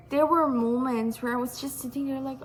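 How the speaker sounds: usual speaking voice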